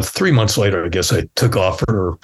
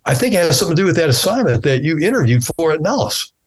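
voiced friend; 7 semitones higher, faster than usual. Voices Phil telling news.

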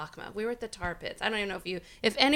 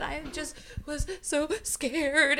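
retold tearful; 6 semitones higher, slower than usual. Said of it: "started crying".

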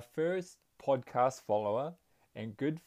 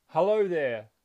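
friendly shout; 4 semitones higher, 7 dB louder than usual. A narrator's reading. Father shouting to the mountain, friendly call. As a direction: call a greeting out to the hills. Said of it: "he shouted to the mountain".

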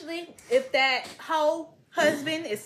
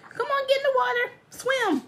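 high, fast urging of an animal; high coaxing call; 13 semitones higher than usual. Retold or imitated. Owner urging the cow into the water, high and fast. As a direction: shoo it along, pitched way up.

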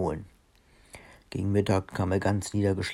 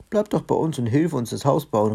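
lively, higher-pitched story-character call; mildly raised voice, urging someone to stay; 5 semitones higher, 4 dB louder than usual. A narrator's reading. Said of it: "rief Olli". (munter auffordernd gerufen)

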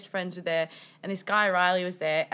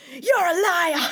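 sudden high, loud accusing yell; retold scream; 8 semitones higher, 6 dB louder than usual. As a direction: blurt it out as a drunken scream. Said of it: "screaming at him".

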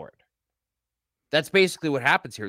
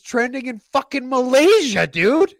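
can you believe it, dude; excited disbelief; 10 semitones higher, 6 dB louder than usual. Real, not acted. Telling his co-host about trending in Malaysia, thrilled and loud. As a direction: pitch jumps high and loud in amazed disbelief. Amazed podcast is trending abroad.

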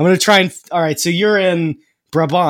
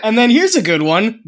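ordinary delivery

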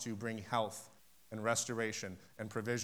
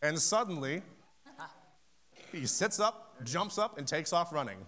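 rising excitement; 5 semitones higher than usual. Real, not acted. Miracle climax of the story.

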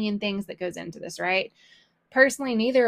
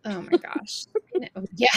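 normal talking voice

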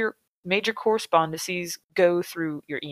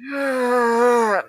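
long, higher-pitched groaning sigh; exasperated groan; 5 semitones higher, 3 dB louder than usual. Real, not acted. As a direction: groan it out in sheer frustration. Fed up with repeated word.

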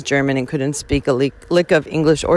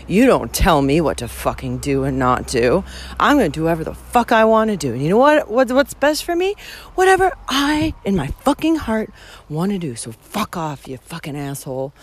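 heated retort climbing in pitch, then dropping off; retold rage. Hiker retelling her answer to breakfast guy, indignant rage. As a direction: replay the outrage, punching the swear words. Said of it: "this fucking rage".

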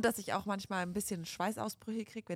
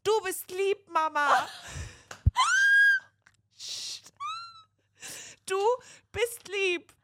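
put-on loud, high toddler voice; 12 semitones higher, 9 dB louder, slower than usual. Mother imitating her daughter embarrassing her in public, very loud. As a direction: imitate a small child blaring it out. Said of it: "Und dann sagt Alea ganz laut".

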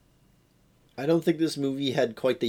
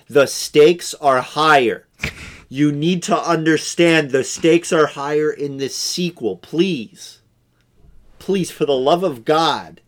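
loud, higher, emphatic melodrama; mock pleading; 4 semitones higher, 6 dB louder than usual. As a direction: implore the audience with hammed-up urgency. Mock pleading of the film to audience.

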